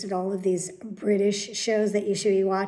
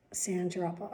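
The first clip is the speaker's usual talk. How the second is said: ordinary delivery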